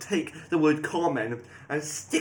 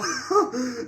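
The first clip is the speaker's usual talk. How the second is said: high burst of laughter; triumphant laugh; 8 semitones higher, 4 dB louder than usual. Real, not acted. Player laughing at his prediction coming true, high delighted laugh.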